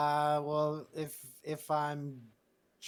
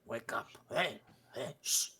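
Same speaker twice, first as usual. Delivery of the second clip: Goliath's soft, secretive whisper; hushed waking whisper; 6 dB quieter, slower than usual. Acted. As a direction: whisper to rouse one sleeper without waking another. Boris wakes Aveline, Carl asleep.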